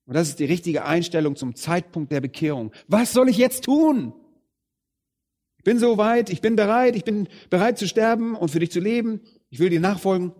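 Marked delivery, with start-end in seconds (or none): 2.9-10.3 high, louder, eager plea; Paul pleading to serve his Lord, high and eager. Retold or imitated. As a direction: sound like a man begging to be told what to do. Paul on Damascus road, eager.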